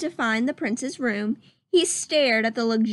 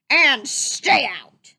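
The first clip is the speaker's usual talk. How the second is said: louder, slow gruff shout as Pete; 5 dB louder, much slower than usual. Acted. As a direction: bark him off the grounds. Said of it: "Captain Pete shouted".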